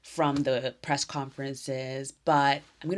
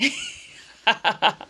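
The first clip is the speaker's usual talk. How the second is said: a high burst of embarrassed laughter; loud, high embarrassed laugh; teary laugh; 9 semitones higher, 6 dB louder than usual. Real, not acted. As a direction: laugh through the emotion. Laughs at her own tears.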